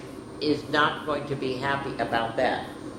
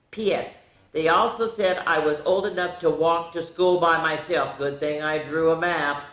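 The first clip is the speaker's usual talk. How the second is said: lively storybook read-aloud, a bit higher; animated picture-book narration; reading to children; 5 semitones higher, 5 dB louder than usual. A narrator's reading. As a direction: read the diary like a bedtime story. Reads diary entries aloud to child.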